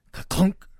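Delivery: loud, high "ka-clunk"; crashing sound word. A narrator's reading. Narrator voices bed legs falling off, sudden loud clunk.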